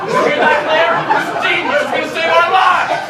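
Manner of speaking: high, loud mockery; bellowed, scornful false praise; sarcastic outburst. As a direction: shout with mock amazement. Mocking the guesser.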